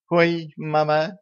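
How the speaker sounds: slow high mock gasp clutching her chest; having a heart attack. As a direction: play a hammed-up heart attack. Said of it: "схватилась за грудь Роксолана".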